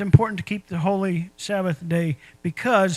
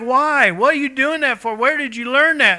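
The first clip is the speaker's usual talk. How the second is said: higher, louder exasperated parent scolding; 6 semitones higher, 6 dB louder than usual. Retold or imitated. Parent scolding the child who messed up, exasperated. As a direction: demand answers from the kid.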